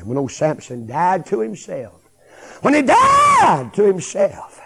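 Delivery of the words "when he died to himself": slow, high, very loud cry; preaching shout. Real, not acted. Preacher driving home Samson's death to self, shouting it slowly. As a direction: shout it out, stretching the words.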